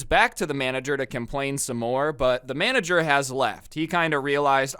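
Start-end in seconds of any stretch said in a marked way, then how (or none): none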